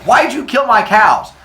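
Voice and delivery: acted furious demand; angry yelling. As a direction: confront them in outrage. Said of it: "start yelling back and forth".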